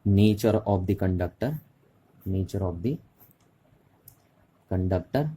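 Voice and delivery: very slow, words spaced; drawn-out teacher dictation. Shown as a demonstration. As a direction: dictate it for notes. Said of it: "पहला किस पे".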